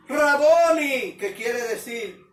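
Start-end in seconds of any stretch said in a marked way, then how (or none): 0.1-1.2 grito alto y alargado al reconocer al maestro; exclámalo fuerte, alargando las vocales; exclamación de asombro. Retold or imitated. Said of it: "exclamó en hebreo". (exclamación sonora y prolongada)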